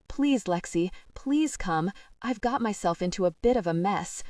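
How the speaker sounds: ordinary delivery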